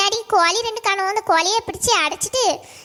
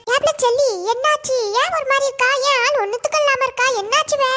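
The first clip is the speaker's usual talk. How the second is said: higher-pitched, concerned questions; concerned questioning; 5 semitones higher, 3 dB louder than usual. Acted. Woman asks her friend why she looks unwell, concerned questioning. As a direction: press a downcast friend to open up.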